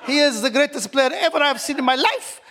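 put-on playful Arabic accent with wallahi. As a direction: gush about Mahrez in an Arabic accent. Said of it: "I'm gonna keep talking in the Arabic accent".

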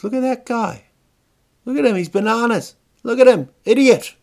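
high, loud Kiwi heckler; mocking shout in a Kiwi accent. Young guy jeering from a car at a banana mascot, Kiwi accent. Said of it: "Real Kiwi kind of".

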